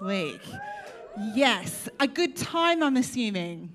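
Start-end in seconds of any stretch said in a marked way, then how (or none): none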